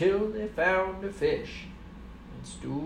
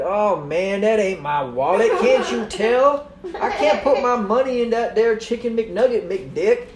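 exasperated yokel; 6 semitones higher, 12 dB louder than usual. Acted. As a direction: scold him with a laugh. Stoogie exasperated, folksy speech.

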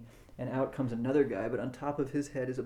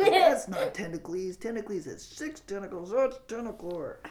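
high, insistent put-on fan voice; arguing fan; 8 semitones higher, 6 dB louder than usual. Game master voices fans bickering over monster names, high argumentative voice. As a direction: correct him in a raised, higher voice. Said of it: "So they're up there arguing".

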